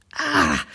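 low, effortful "ah"; stretched-to-the-limit grunt; straining groan. A narrator's reading. Straining to reach the branch.

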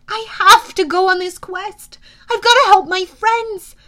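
high, loud, insistent; urgent plea. Acted. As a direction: plead your case desperately. Hero pleads to continue the quest.

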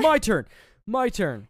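high loud gleeful demand; excited repeated yell; eager shout. Retold or imitated. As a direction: grab your turn like a kid. Killer grabs the knife eagerly.

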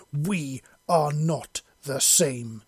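loud, very slow, each word hammered; slow furious bellow. A narrator's reading. Shauna rejects Faith's claim, slow loud furious bellow. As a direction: roar it out word by word. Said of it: "Shauna bellowed".

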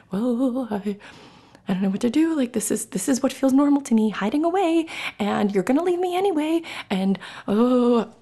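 high, panicky fretting, rising as it goes; shaking, scared crab. Acted. Podcaster acting out herself stonewalling in fear, scared crab. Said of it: "I'm shaking in my shell going".

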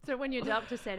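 normal talking voice

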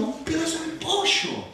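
high, soft, longing voice for chicken; craving voice. Acted. Preacher mocking his own craving, high and soft. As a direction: make the fried chicken sound irresistible.

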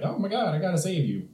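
high mock panic; lightly hammed-up alarm; mock alarmed Jiminy. Jiminy seeing Pinocchio caged, high mock panic. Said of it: "is like".

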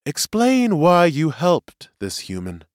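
high, slow, measured demand; presiding seer. Acted. Seer questions the prisoner, commanding. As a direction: demand an account from the witness.

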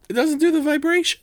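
high-pitched disbelieving question; incredulous. Real, not acted. Host questioning co-host about the phone, incredulous. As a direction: voice shoots up in disbelief.

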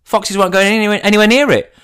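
high, fast airy voice of a clueless friend; imitating Helen. Mocking friend Helen's cling film plan, high and fast. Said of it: "She goes".

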